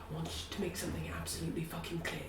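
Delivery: low, very soft, rapid; hushed warning. Acted. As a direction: hurry it out in a private undertone. Character privately messages another, stern.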